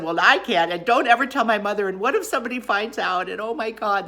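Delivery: loud, high frantic rush of worries; acted panic. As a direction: spiral through the what-ifs in hammed-up alarm. Acted frantic reply to husband.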